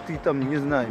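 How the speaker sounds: ordinary delivery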